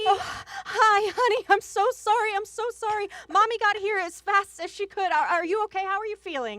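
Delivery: high, loud, rushing concern; frantic apology. Acted. Mother arriving late to sick daughter, frantic apology.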